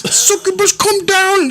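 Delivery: high falsetto quoting a female cartoon character; woman's voice impression. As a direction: pitch way up like the lady on the show. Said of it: "she said".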